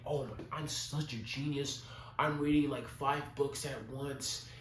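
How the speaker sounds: high, slow, self-satisfied mock voice; mocking smug genius. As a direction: parody a smug know-it-all. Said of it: "we're gonna think".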